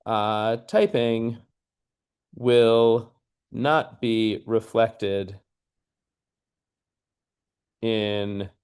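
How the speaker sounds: very slow, with long pauses between words; dictating while typing. Real, not acted. Lecturer types a sample sentence aloud, slowly dictating. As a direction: stretch each word out as you type it.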